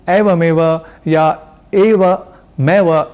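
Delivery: एवमेव drawn out very slowly with the wrong ए; एवमेव said slowly, the ए flattened the regional way; wrong pronunciation. Shown as a demonstration. Teacher showing students the mistaken एवमेव, slowly. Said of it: "नहीं कहना".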